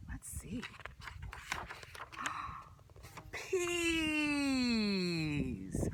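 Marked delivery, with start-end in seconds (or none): 0.7-2.6 gasp. Acted. Surprise at next food. 3.3-5.9 excited shout. A narrator's reading. Food reveal for children.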